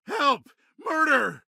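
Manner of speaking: high, screaming for help in panic; panicked cry for help. Acted. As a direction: shriek it like a terrified witness. Bystander screams during street attack.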